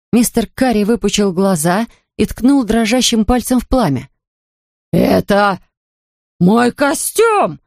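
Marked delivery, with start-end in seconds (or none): 4.9-7.6 roar. A narrator's reading. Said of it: "взревел он".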